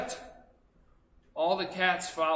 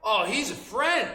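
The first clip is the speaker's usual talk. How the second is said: higher, louder happy answer; name your friend gladly; a hint of a cheerful child; 7 semitones higher, 5 dB louder than usual. Child telling instructor the cat is a friend, mildly cheerful. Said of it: "I said".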